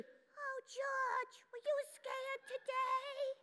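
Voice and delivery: high, soft mommy falsetto; put-on high, gentle mom; Mommy's voice. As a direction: coo it in a tiny, high mother voice. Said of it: "well, she sounds like this".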